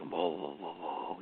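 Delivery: low, soft, repeated "whoa"; hushed wobbling string of whoas; wordless noise. Shown as a demonstration. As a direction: make quiet pulsing noises down low. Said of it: "kind of make the noises".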